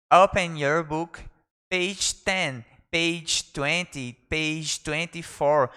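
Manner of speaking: slow, firm classroom command; drawn out for learners to copy; slow model command. Shown as a demonstration. Teacher modelling class instruction, slowly. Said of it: "teacher models phrase slowly".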